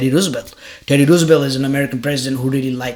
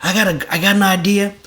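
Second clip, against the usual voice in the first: higher-pitched, eager retold pitch; mock eager greeting; 6 semitones higher than usual. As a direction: hail the president with a bright idea, playfully acted.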